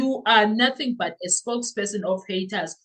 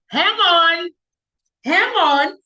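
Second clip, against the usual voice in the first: much higher and louder hang on; excited interruption; 9 semitones higher, 8 dB louder, slower than usual. Real, not acted. Vlogger stops reading, excited and loud. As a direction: stop everything, you've spotted something. Noticing a familiar speaking style.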